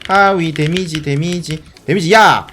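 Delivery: normal talking voice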